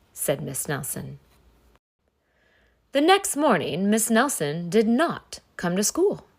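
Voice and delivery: usual speaking voice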